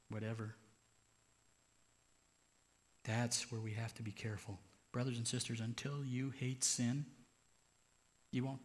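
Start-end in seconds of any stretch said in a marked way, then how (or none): none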